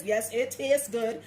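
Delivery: ordinary delivery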